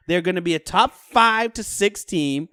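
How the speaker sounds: normal talking voice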